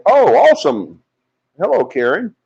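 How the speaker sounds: high, loud burst of delight; excited. Real, not acted. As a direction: gush over the news. Delighted greeting to a viewer.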